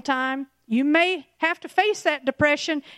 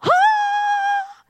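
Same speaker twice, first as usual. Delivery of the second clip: high, sustained startled scream; hammed-up shriek on a held high note; mock scream; 4 dB louder than usual. Preacher acts out fleeing the pit bull, mock scream. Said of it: "And you're like".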